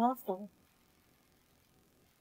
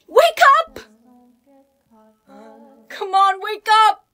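high, loud desperate yelling; desperate shout; 4 semitones higher, 9 dB louder than usual. Acted. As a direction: scream for him to wake. Trying to wake someone.

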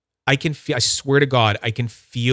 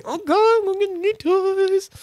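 very high, drawn-out squeal of mock glee; giddy squeal; slower than usual. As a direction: squeal slowly in falsetto like an overexcited kid. Acts own glee at new toys.

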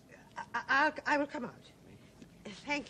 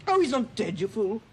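loud, furious snap; angry outburst; 14 dB louder than usual. Acted. Abandoned woman shouting at her friend, loud and angry. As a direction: lash out, hurt and exasperated.

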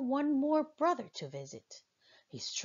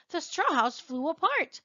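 ordinary delivery

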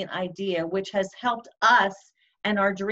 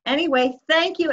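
ordinary talk, nothing marked

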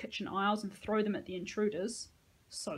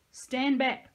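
sharp, louder command; urgent warning; 5 semitones higher, 7 dB louder than usual. A narrator's reading. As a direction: order her back fast. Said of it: "I told Astrid".

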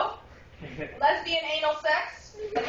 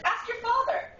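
mother's voice; 9 semitones higher than usual. Retold or imitated. Mother's reply retold.

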